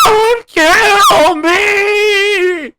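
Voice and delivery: long, high, wailing plea; drawn-out scream. Retold or imitated. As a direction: stretch the scream out desperately. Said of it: "was that an accurate recreation".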